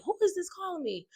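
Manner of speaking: high, quick and hushed puzzlement; mock confusion at a strange caller; puzzled question. Bride wondering who the unknown number is, puzzled and hushed. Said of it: "and I'm like".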